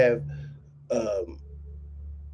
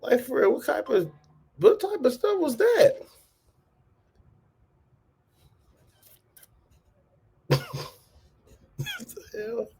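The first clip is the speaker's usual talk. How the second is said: much higher, incredulous what-the-hell; stunned disbelief; 14 semitones higher, 3 dB quieter than usual. Real, not acted. Reacting to a strange clip, high incredulous disbelief. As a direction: squeak it out in shocked disbelief.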